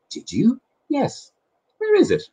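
put-on the boy in the book asks the mole; boy's voice. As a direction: read it as the curious little boy. The boy asks where the cake is.

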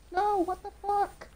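high, loud, real exasperation; exasperated outburst. Real, not acted. Player losing to the boss, exasperated outburst. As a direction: cry out in disbelief and frustration.

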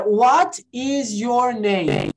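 very slow, word-by-word "what is your name"; overcareful textbook question; classroom English. Shown as a demonstration. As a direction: stretch each word of "what is your name" like a language drill. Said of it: "a gente fala assim".

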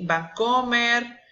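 ordinary delivery

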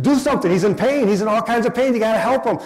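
high, loud, panicked begging; family begging the nurse to do something, panicked; panicked plea. Retold or imitated. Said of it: "we're starting to panic".

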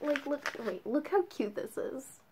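ordinary delivery

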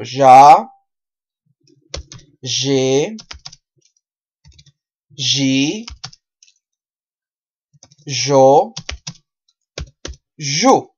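"Ya, G, G, Yo, Yu" as zhah, zhe, zhee, zho, zhu; spaced syllables, each starting with zh; repeat-after-me drill. Shown as a demonstration. Teacher has learners repeat the J sound, drill voice. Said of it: "Repito conmigo".

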